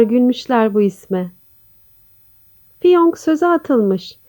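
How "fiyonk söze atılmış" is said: plain reading voice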